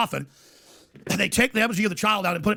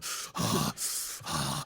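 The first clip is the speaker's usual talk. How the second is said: long, heavy voiceless breaths; imitated enraptured sighing; 9 dB quieter than usual. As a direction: mimic cultists panting in a trance. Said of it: "They were just going".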